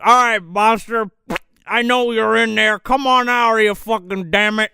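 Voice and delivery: police chief calling the monster out over a bullhorn; bullhorn callout. Retold or imitated. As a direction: bark it through a bullhorn at the house. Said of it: "He gets on a fucking, uh, bullhorn".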